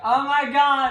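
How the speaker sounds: high, loud acted alarm; hammed-up shock; mock panicked cry. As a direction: cry out as if about to be crushed. Acting out Dick under falling statue.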